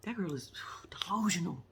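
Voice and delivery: hushed disbelieving whisper; soft whispered verdict; whispered aside. Real, not acted. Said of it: "aside about the girl".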